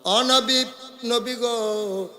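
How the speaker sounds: high, drawn-out plea to get attention; calling out. Acted. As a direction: call out high, then stretch the second call. Woman calls out to the Prophet. (উঁচু গলায় টেনে ডাকা)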